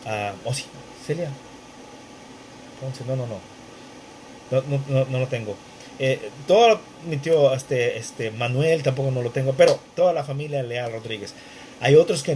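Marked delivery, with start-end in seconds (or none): none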